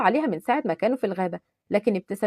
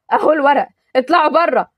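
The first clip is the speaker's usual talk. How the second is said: louder, higher furious order; angry shout; 4 semitones higher, 7 dB louder than usual. Acted. Taha ordering Adham's men out, angry shout. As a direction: throw them out in rage.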